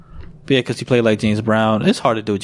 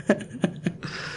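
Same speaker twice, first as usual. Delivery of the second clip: breathy laugh; 9 semitones higher, 11 dB quieter than usual. Real, not acted. Breaks up after the voice.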